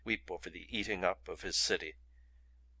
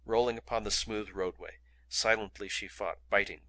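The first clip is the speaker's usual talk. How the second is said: ordinary delivery, nothing marked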